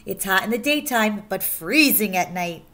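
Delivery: ordinary delivery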